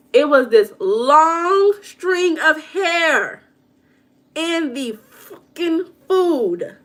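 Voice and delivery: high, loud and very slow, each word dragged out; disgusted outburst. Real, not acted. Telling viewers about hair in her French toast, a slow, loud, disgusted outburst. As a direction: stretch it out in shocked disgust.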